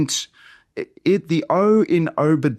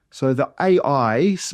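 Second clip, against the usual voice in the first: normal talking voice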